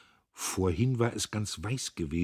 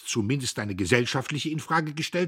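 high, louder, building; indignant accusation; 7 semitones higher, 5 dB louder than usual. A narrator's reading. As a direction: accuse her righteously. Diederich accuses his sister.

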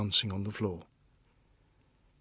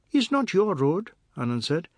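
put-on high, louder child's voice; defiant little boy; 10 semitones higher, 5 dB louder than usual. Anand talks back to the fat man, defiant little boy. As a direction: talk back in a high boy's voice.

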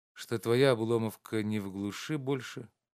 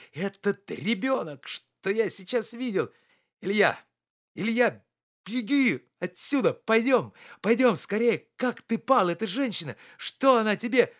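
high, loud, urgent calling; begging Ilya to run away now; anguished pleading; 10 semitones higher, 6 dB louder than usual. A narrator's reading. (надрывно, громко, умоляюще)

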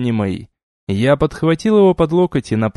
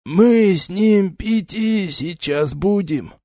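put-on slurred drunk; 6 semitones higher, much slower than usual. Drunk noble keeps slurring.

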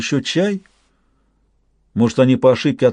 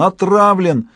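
hoarse cry; 6 semitones higher, slower than usual. A narrator's reading. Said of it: "прохрипел он".

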